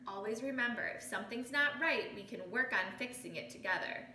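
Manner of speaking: plain reading voice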